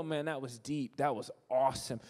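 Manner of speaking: low, hushed awe; quietly impressed. Acted. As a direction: drop low and soft, as if deeply moved. Acting an impressed churchgoer.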